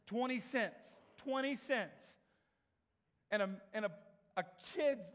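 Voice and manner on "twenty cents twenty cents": twenty cents pitched high, the second drawn out slowly; incredulous emphasis. Shown as a demonstration. Preacher marveling at a fight over twenty cents, high and slow. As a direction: hammer home how tiny the sum was. Stressing absurdly small amount.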